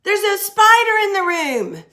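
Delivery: high, loud cry of fright at a spider; alarmed exclamation. Shown as a demonstration. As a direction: shriek it like you just spotted a spider. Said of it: "where we say".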